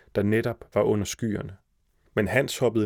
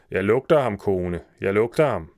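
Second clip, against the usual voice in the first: louder start, easing off; giant's raised voice; 4 dB louder than usual. A narrator's reading. Giant telling his wife he smells someone, mildly shouting. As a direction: insist like a storybook giant, moderately loud.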